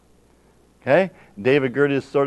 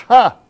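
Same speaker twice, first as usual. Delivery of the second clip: scornful laugh; 9 semitones higher, 10 dB louder than usual. Real, not acted. Scoffing at the naysayers.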